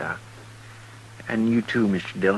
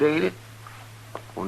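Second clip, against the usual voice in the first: ordinary talk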